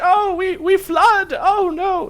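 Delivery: high, loud theatrical wail, slow; lamenting puppet priest. Acted. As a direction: bewail the flood in a hammed-up puppet voice. Game master voices puppet priest.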